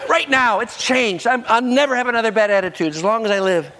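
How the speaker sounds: high-pitched, frantic apology; hammed-up pleading, starting very high and easing down; panicked mock repentance. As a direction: beg for mercy as if caught out. Acts out panicked repentance when caught.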